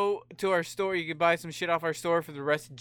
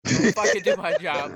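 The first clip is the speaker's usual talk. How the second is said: loud, forceful objection; indignant scolding; 8 dB louder than usual. Real, not acted. Host telling co-host off for taking over, loud indignation. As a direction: tell them off loudly.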